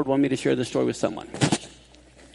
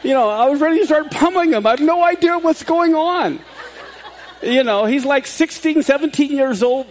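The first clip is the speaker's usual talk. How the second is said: laughing; 9 semitones higher, 5 dB louder than usual. Real, not acted. Laughing retelling the scare.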